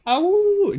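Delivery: high yipping howl; howling like a wolf pup. Pretend. Howl as podcast name.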